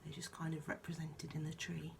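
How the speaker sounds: usual speaking voice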